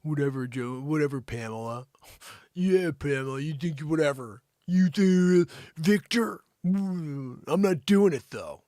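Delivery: mock-dismissive brush-off. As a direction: act out a whatever shrug-off, hammed up. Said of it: "you coulda just been like".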